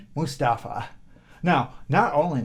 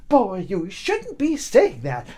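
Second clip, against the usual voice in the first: angry scolding; 5 semitones higher, 5 dB louder than usual. Acted.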